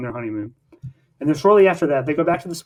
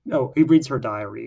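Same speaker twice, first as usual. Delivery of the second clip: ordinary talk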